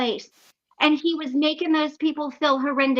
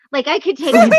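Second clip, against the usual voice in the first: louder, laughing through the boast; 8 dB louder than usual. Retold or imitated. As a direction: brag while laughing. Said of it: "and I'm like".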